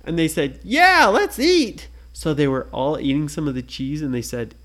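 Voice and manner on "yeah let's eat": higher, louder slow cheer; eager cheer. Acted. The kids agreeing to eat cheese, cheering. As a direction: cheer for the food.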